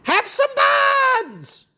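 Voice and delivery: high, drawn-out parrot squawk; slow, high parrot cry. Acted. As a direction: call it out slowly like a parrot. Parrot offers buns loudly.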